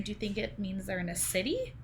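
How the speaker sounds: normal talking voice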